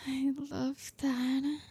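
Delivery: ordinary delivery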